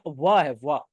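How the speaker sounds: "व" as an isolated w sound; "व" sound shown. Shown as a demonstration. Teacher explaining how "one" begins, "व" sounded out alone. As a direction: sound out "व" as one short w syllable. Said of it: "उसका pronunciation जो है".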